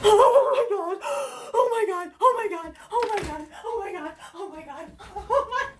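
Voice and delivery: high, breathless repeated exclamations, loud then fading; overjoyed gasping. Real, not acted. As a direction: burst out ecstatic, then trail into laughter. Overjoyed reaction to book scene.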